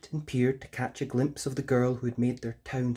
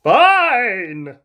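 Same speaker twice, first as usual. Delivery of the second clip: prince's sulky reply; 10 semitones higher, 13 dB louder than usual. Acted. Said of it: "said the prince".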